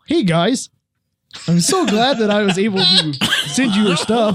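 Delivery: a hammed-up Canadian accent with laughter breaking through; Canadian accent as [name]. Reading a fan's letter aloud, in a mock Canadian accent. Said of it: "[name], you sound like an idiot".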